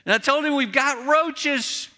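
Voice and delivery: a hint of the frustrated wife complaining; imitating his wife. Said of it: "And she said".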